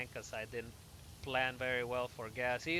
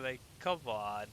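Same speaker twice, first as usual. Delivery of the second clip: normal talking voice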